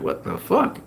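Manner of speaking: high, soft, replayed astonishment; stunned disbelief. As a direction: breathe out your shock, pitched high and rising. Acting out his stunned reaction.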